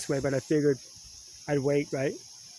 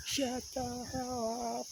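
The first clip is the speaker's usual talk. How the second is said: high, very slow, softer mock bullhorn call; mock police loudspeaker; 10 semitones higher, 7 dB quieter, much slower than usual. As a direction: drag it out like a pretend cop megaphone. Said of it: "that loudspeaker that the cops used to use".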